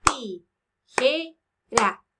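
"Tijera" very slowly as ti, je, ra; "Tijera" counted out in three separate syllables. Shown as a demonstration. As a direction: pause after each syllable of "Tijera". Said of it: "¿Cuántas tiene?".

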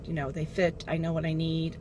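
ordinary talk, nothing marked

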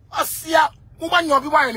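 indignant cry. Real, not acted. Heated rant outburst.

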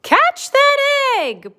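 high, loud hen's cry; acted urgent shout; storybook yell. A narrator's reading. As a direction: yell it in alarm as Chicken, falling on the last word. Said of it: "poor Chicken yelled".

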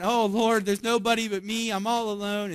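mock the prophet's pity party; self-pitying whine. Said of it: "He throws himself a pity party".

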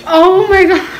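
gasping from the spice heat; reacting to burning hot noodles; mouth burning from spicy food. Real, not acted.

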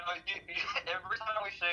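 usual speaking voice

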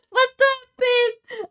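high, loud frightened cry; scared. Real, not acted. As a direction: cry out in real alarm. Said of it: "I got scared".

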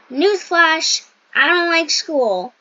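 loud mock announcement; mock newsflash. Real, not acted. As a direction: announce it like breaking news. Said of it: "announces a newsflash".

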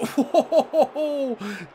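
real burst of laughter; amused laugh off the bar. Real, not acted. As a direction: laugh at the near miss. Shot hits the bar.

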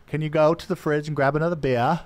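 hammed-up Australian, asked by friends on the show; mock Australian accent. Shown as a demonstration. Hamming up an Australian accent for a friend's request. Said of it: "Louis wants me to do an accent".

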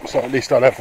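usual speaking voice

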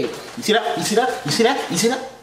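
high, rapid-fire mock threat; playful taunting. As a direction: taunt in a high pitch, repeating it quickly. Play threat, showing quick hands.

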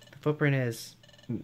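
ordinary talk, nothing marked

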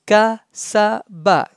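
Kasaba in three separate pieces; syllable by syllable. Shown as a demonstration. Teacher breaking the word up for students, syllable by syllable. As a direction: pause between ka, sa and ba. Teacher spells out, then joins.

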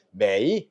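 매이 with a full low dip and rise; unchanged third tone. Shown as a demonstration. Teacher shows the unchanged tone, 매이 dipping low. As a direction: say 매이 low, dipping before it lifts. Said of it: "그대로 발음하면 돼".

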